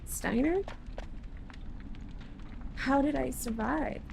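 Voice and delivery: hushed, bewildered princess voice; weak, waking princess. Acted. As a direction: speak gently, just coming round.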